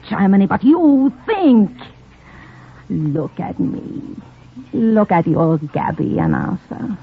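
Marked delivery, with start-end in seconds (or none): 2.9-7.0 slow, soft look at me; coaxing plea. Acted. Spy soothing her suspicious lover, soft and coaxing. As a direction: draw him in gently. She pleads to win his trust.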